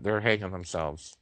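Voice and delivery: usual speaking voice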